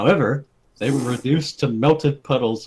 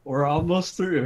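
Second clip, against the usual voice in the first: ordinary talk, nothing marked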